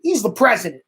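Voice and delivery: ordinary talk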